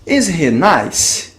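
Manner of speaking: slow, careful model sentence; very slow, deliberate teacher delivery; slow teaching demo. Shown as a demonstration. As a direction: spell it out slowly for the class. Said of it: "Então vamos ver algum exemplo".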